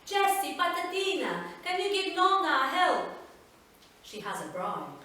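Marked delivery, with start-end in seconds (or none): 0.1-3.1 high, loud Italian grandmother calling out; Italian nonna calling. Acted. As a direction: call to the child like a fond Italian nonna.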